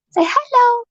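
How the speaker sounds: high sing-song hello to a pet; high cooing greeting to a hamster; pet talk. Real, not acted. Talking to the pet.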